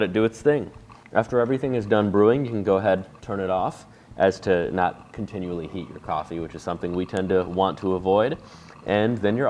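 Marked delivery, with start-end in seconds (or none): none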